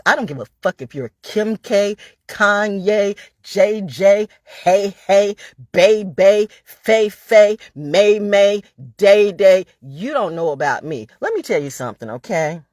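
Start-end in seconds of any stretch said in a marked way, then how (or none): 0.0-9.6 names fired off louder and louder; escalating defiant roll call; building rant. Real, not acted. Ranting at critics, voice climbing louder.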